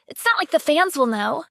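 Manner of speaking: plain reading voice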